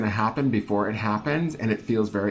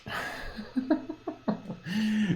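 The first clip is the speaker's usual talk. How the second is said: high-pitched surprised laugh; 12 semitones higher, 6 dB quieter than usual. Real, not acted. Laughs at the card.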